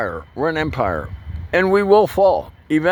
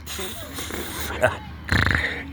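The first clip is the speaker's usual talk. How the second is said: high unvoiced laugh; 6 dB quieter than usual. Real, not acted. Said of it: "Can't hardly say that without laughing".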